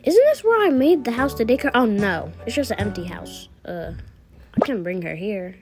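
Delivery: usual speaking voice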